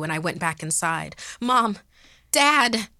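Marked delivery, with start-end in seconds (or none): 1.4-2.9 higher, louder calling out; excited shout. A narrator's reading. Girl calling her unconscious parents, shouting with regained voice. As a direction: shout to wake them. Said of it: "I shouted to them".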